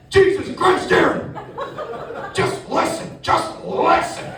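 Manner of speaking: high, strained panicked father; frantic dad. Retold or imitated. Dad on the phone to his son, panicked. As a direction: rasp it out like a dad at wits' end.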